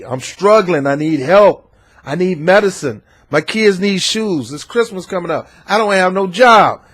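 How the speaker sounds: lightly voiced desperate beggar; pleading struggling people. Struggling people begging the rich man, lightly voiced pleading. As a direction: plead for work and help. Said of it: "there's a lot of people out here saying".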